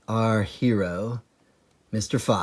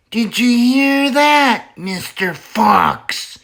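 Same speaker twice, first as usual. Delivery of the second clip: high, louder yell, Mister Fox slow; yelling Bean; 13 semitones higher, 6 dB louder, slower than usual. Acted. Bean shouting down the hole at the fox. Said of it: "yelled Bean".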